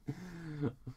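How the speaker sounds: soft real chuckle; real laugh. Real, not acted. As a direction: laugh at yourself. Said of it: "I had to do it".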